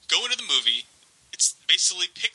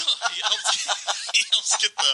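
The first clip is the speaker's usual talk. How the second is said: laughing; 7 semitones higher than usual. Real, not acted. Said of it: "Gets the guy off".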